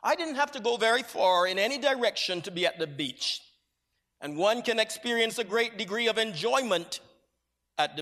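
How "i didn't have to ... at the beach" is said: normal talking voice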